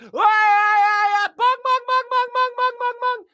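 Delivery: very high, loud mocking wail, then high bongs; mock wail and bells. Mocking religion's noise outside, a very high loud wail. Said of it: "they're like".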